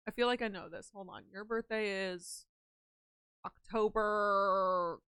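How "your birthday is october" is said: slow, searching drawl; drawn out slowly. Real, not acted. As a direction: stretch each word out very slowly while thinking. Drawing out while reading chart.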